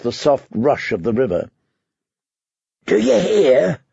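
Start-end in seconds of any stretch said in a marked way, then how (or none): 2.9-3.8 raspy whisper. Acted. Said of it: "asked in a hoarse whisper".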